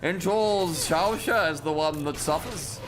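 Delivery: lightly voiced posh caster accent; a hint of a theatrical casting accent; put-on casting accent. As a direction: slide into a mock grand caster accent. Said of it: "this is the accent I shall cast with".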